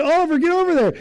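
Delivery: high, loud urgent call across the field; retold yell to son. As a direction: shout him toward the plate. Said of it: "I'm, like, yelling".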